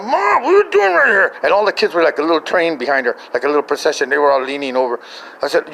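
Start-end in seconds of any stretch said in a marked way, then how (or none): 0.0-1.3 high, loud exasperated shout; retold outburst of startled disbelief; startled mock yell. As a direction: yelp it at mom in comic alarm. Startled, yells at mom behind him.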